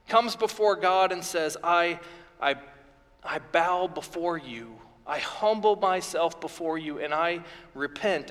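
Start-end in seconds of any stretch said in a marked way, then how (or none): 1.6-8.3 much softer, bowed, humble voice; humble confession. Acted. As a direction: speak it as a broken man bowing low. Job humbles himself before God.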